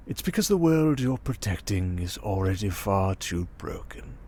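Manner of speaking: ordinary talk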